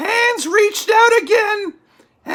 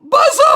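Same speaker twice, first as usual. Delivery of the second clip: loud higher windy howl; wind howl; 5 semitones higher, 5 dB louder than usual. A narrator's reading. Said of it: "It went".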